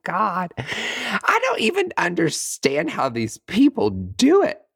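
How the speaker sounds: high, slow exasperation; hammed-up baffled complaint; frustrated disbelief. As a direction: draw it out high, climbing to the end. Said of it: "So I get frustrated".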